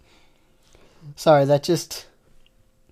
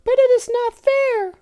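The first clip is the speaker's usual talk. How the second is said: very high, loud mock protest; toy-voice whine; squeaky Lala; 5 dB louder than usual. Lala protesting to Snape, very high.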